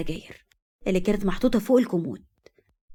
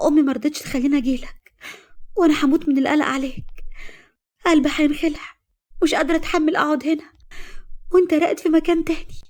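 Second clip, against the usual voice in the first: put-on higher, anxious young woman; worried girlfriend; 7 semitones higher than usual. A narrator's reading. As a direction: pour out your worry.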